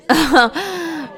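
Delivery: high, mocking burst of laughter; scornful laugh. Mocking the bully's insult with a contemptuous laugh. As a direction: laugh in his face.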